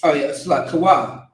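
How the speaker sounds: chanted, line-by-line prayer for the group to repeat; steady devotional call and response; prayer leading. Real, not acted. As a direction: lead the prayer one phrase at a time.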